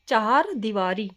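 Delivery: चार दीवारी slowly as "cha-haar deevaari"; correct extra syllable. Shown as a demonstration. Teacher repeating the correction, slow cha-haar in चार दीवारी. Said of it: "क्या होता है?".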